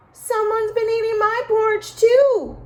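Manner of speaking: high, loud whiny cub; a little bear pouting about his porridge; sulking Baby Bear. A narrator's reading. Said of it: "sulked Baby Bear".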